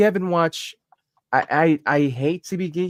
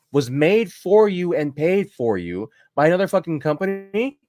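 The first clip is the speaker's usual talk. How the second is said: normal talking voice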